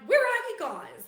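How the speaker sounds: high, loud demand down the phone; exasperated call. Retold or imitated. Locked-in girlfriend phoning the boys, loud and exasperated. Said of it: "I was like".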